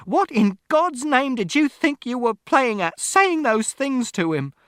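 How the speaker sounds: very high, slow, loud reproach; outraged shout. A narrator's reading. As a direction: shout it, stretching the words. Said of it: "Mother shouted".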